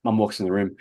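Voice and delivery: ordinary talk, nothing marked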